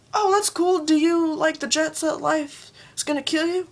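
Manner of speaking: sweetly interrogating a fellow fan; mock-friendly quizzing. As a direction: test them with fake friendliness. Acting a testing fellow fan.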